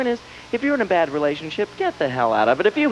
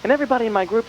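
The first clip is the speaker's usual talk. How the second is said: ordinary talk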